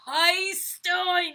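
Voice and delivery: loud, high call; big storytime holler; children crying out. A narrator's reading. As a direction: shout the answer like a class. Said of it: "What do you think they cried out?".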